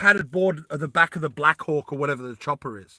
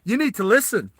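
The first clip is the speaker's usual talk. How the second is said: higher-pitched Southern warning; Southern accent; 5 semitones higher than usual. Acted. [name]'s character tells off the Russian, Southern accent. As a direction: put on a real Southern accent. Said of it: "he's got a real Southern accent".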